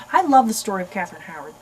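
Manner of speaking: ordinary talk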